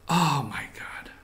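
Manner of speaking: exasperated whisper; sighing whisper aside. Real, not acted. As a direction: drop to a whisper in disbelief.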